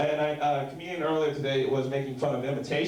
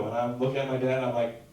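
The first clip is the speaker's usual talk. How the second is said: ordinary talk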